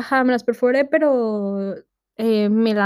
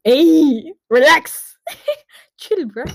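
loud mock protest; 5 semitones higher, 6 dB louder, much slower than usual. Telling teasing tattooists to relax.

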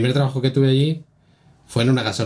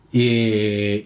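ordinary delivery

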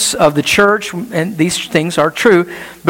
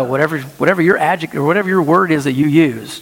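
ordinary talk

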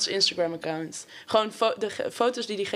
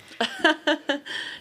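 suppressed little laugh; stifled giggles; 7 semitones higher than usual. Real, not acted. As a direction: giggle held back. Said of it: "dan mag jij niet lachen". (ingehouden gegiechel)

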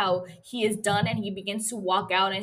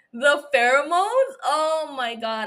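slow, louder, higher and swooning; giddy and flustered; 5 semitones higher, 6 dB louder, slower than usual. Real, not acted. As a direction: drag it out, overwhelmed. Fangirling over the line.

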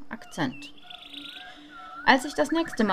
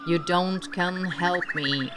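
put-on British accent; slower than usual. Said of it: "Er hatte einen britischen Akzent".

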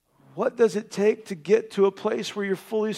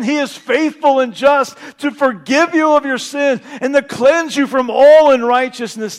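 loud, higher rousing sermon; rousing full-force preaching; fervent preaching; 4 semitones higher, 6 dB louder than usual. Acted. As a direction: proclaim forgiveness triumphantly.

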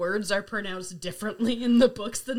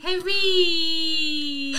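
higher, louder whine with a long Y; Hermione; 4 semitones higher, 5 dB louder than usual. Acted. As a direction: stretch the ending in a mock whine. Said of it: "his whiny Hermione voice".

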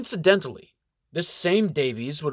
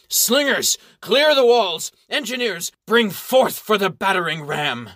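loud high battlefield orders; shouted commands; 7 semitones higher, 5 dB louder than usual. Acted. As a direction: bark orders across the field.

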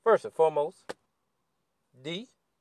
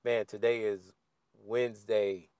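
usual speaking voice

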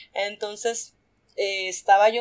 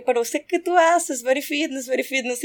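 put-on higher, Russian-accented insisting; mock Russian insisting; 4 semitones higher than usual. Stubborn Belarusian guy insisting, higher mock Russian accent. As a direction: keep pushing in a Soviet accent.

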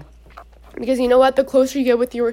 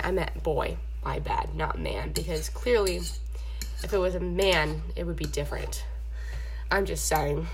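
much lower, softer correction; muttered aside; 7 semitones lower, 7 dB quieter than usual. Real, not acted. Correcting man to boy for her listeners, low and soft. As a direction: drop the voice for a sly aside. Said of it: "aside correcting herself".

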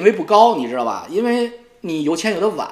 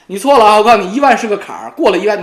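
loud warning; 3 semitones higher, 7 dB louder, faster than usual. Real, not acted. Warning rival loudly.

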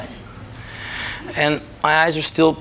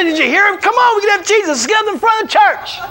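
much higher, louder excited shout; giddy shouted pleading; excited urging; 3 dB louder, faster than usual. Retold or imitated. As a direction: drag them along with your voice. Pushing friends toward the altar.

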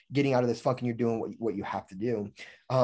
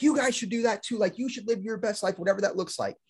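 high, eager pep talk; a lightly voiced preacher of the good life; excited preaching; 7 semitones higher than usual. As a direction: hype them up to live big. Retelling own preaching.

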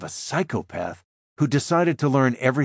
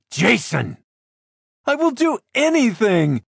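much higher, frantic begging; desperate pleading; 12 semitones higher, 4 dB louder than usual. Acted. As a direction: beg for your life, voice pitched up. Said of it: "I barely recognize my own voice".

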